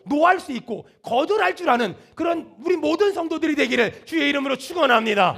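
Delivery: full-force preaching. Real, not acted. Preacher urging the congregation to amen, full-force preaching. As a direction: build to a loud blessing over the congregation.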